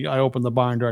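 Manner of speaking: normal talking voice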